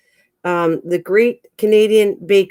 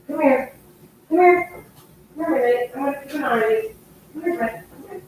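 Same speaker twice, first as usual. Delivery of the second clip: high-pitched pet call, repeated; calling a cat; 6 semitones higher, 4 dB quieter than usual. Real, not acted. Owner keeping an eye on Midnight, calling the cat in a high voice. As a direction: coax a pet over in a higher voice.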